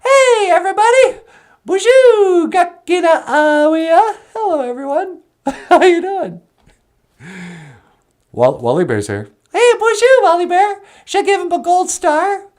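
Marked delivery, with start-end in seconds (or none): 0.0-6.4 very high, bright greeting; a cheerful high puppet hello; Natasha's puppet voice. Acted. 9.5-12.5 very high, bright greeting; a cheerful high puppet hello; Natasha's puppet voice. Acted. Puppet Natasha greets Wally Bear.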